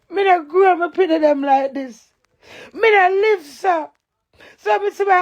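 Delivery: high and loud, emotion rising. Real, not acted. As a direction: protest hard, voice climbing.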